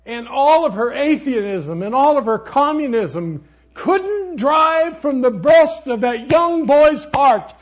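preacher's shouted climax, slow and soaring; full-force preaching. Acted. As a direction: thunder it from the pulpit.

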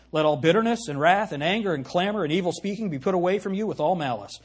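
loud scripture proclamation; preacher thundering scripture; preaching. Acted. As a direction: declare the verse over them. Reading verse with force.

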